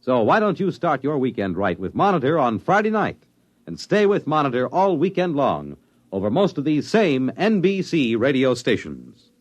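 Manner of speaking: ordinary delivery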